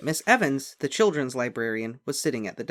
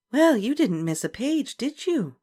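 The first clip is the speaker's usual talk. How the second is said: librarian; 11 semitones higher, 4 dB louder than usual. A narrator's reading. Librarian voiced.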